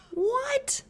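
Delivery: a squeaky high What, hammed up for kids; mock astonishment. Storyteller to young listeners, high playful surprise. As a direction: gasp it out in playful disbelief. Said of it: "mock astonishment for children".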